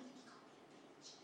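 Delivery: whispered, almost inaudible; hushed confession. Real, not acted. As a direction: drop to a whisper for the rude word. Drops to whisper for rude word.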